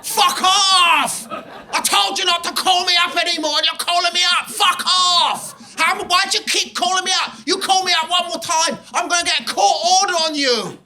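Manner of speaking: high-pitched furious mock screaming; shrieked, hammed-up phone tirade. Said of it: "she was just screaming into her phone".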